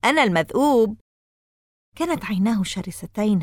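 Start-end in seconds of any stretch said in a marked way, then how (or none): 0.0-1.0 shout. A narrator's reading. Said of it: "وصاح قائلًا".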